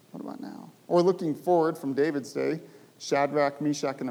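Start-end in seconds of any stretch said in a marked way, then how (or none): 0.1-0.7 breathy whispered question; whispered plea. Acted. Preacher imagining a hushed plea to God, whispered.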